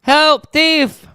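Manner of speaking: loud, high-pitched comic yell; hammed-up high shout for help; mock cry for help. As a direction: call out like a comic-book victim. Acting comic character calling out.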